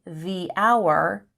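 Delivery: THE as "thee" before hour, H silent; slow thee for THE, hour starting "ow"; long THE. Shown as a demonstration. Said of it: "we would say".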